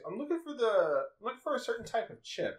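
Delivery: mock lady shopper, high and unsure; imitating a lady customer. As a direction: ask haltingly, pitched well up. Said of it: "there used to be a lady".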